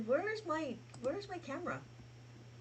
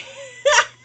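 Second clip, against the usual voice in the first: high giggling laugh; high loud laugh; 13 dB louder than usual. Real, not acted. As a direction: burst out laughing. Still cracking up.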